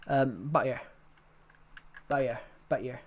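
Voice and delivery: butter said as bɑjəɹ, t gone; Butter with the t turned to a y-glide; thick accent butter. Shown as a demonstration. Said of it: "the accent's so thick".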